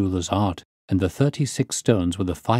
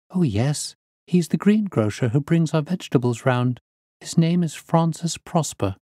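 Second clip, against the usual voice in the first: put-on higher-pitched young woman's reply; helpful niece witness; 7 semitones higher than usual. Niece telling the detective who the man is, high and helpful. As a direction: explain readily in a raised pitch.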